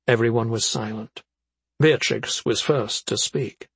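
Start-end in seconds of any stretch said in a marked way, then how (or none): none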